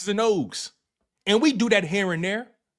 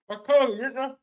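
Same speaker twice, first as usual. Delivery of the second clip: ordinary talk, nothing marked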